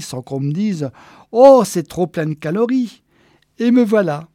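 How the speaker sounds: high, loud, indignant gush; self-satisfied Geneviève, triumphant. Acted. Geneviève explains to Walter why she stayed, triumphant. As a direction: build to a gleeful flourish.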